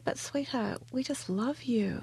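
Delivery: soft and slow, gently comforting; tender reassurance. Retold or imitated. Telling the crying café girl, softly and tenderly.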